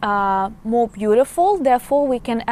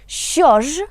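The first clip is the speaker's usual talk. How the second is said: Що ж said higher and louder, questioning; emphatic що ж; 5 semitones higher, 4 dB louder than usual. Shown as a demonstration. Teacher demonstrating an emotional Що ж to students, louder and higher. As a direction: lift Що ж up in a loud, emphatic ask. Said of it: "how we can translate it".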